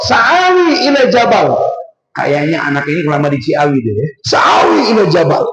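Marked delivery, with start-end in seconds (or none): 0.1-1.6 defiant retort. Retold or imitated. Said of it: "Si anak kurang ajar jawab". 4.2-5.4 defiant shout. Retold or imitated. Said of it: "Si anak kurang ajar jawab".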